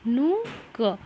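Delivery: नु, क as separate sounds; broken into syllables. Shown as a demonstration. Teacher helps weak readers, sounding out syllables. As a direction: sound out नु क piece by piece. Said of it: "थोड़ा थोड़ा short short करके".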